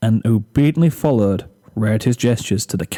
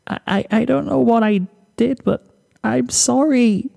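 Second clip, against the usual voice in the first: whimpering apology; 12 semitones higher than usual. Acted. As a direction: plead through tears. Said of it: "choked whimpers spilling out of her".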